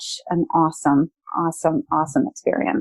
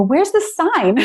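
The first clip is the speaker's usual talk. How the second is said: surprised question; 9 semitones higher, slower than usual. Retold or imitated. Asking a support driver mid-ride.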